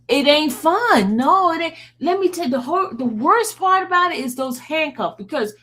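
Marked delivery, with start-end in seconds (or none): none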